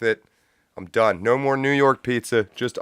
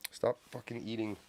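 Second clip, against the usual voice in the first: much softer, muttered at the cat; quietly scolding the cat; hushed scolding; 18 dB quieter than usual. Real, not acted. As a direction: grumble at the cat under your breath.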